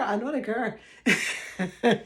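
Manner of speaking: slow, laughing echo of the claim; laughing repeat. Retold or imitated. Repeating the viewers' claim to her audience, laughing slowly. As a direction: let it dissolve into a laugh. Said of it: "And people were saying".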